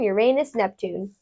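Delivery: ordinary delivery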